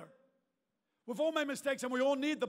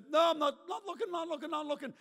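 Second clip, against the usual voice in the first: higher, hurried string of refusals; acted stubborn avoidance; mock refusal; 4 semitones higher than usual. Person refusing the creeping memory, mock-insistent refusal.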